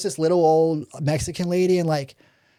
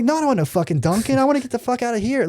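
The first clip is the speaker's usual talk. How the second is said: higher-pitched, rattled refusal; retold panic; 7 semitones higher, faster than usual. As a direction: act out a jittery, urgent refusal. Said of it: "I'm, like, shaking like a leaf".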